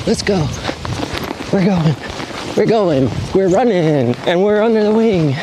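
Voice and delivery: high-pitched, breathless cheering as the wing rises; thrilled. Real, not acted. To himself as the wing finally lifts: high excited cheering. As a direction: celebrate every second the wing stays up.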